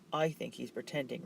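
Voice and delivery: ordinary delivery, nothing marked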